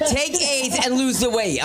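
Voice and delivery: high bright ad read; high mock commercial slogan. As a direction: sell it like a TV jingle. Old ad slogan.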